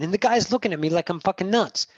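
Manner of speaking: normal talking voice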